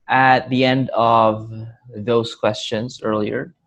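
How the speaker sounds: usual speaking voice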